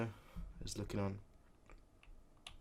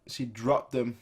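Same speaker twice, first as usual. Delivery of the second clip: ordinary delivery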